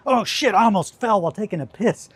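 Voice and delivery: loud, high-pitched mock yelp of alarm. Pretending to nearly fall mid-piss, mock-alarmed. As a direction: exclaim it as if you just slipped. Acts out near fall at urinal.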